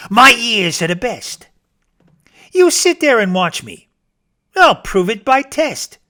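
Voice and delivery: high, loud eager boast; confident rabbit. Acted. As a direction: promise the proof proudly. Rabbit voice held.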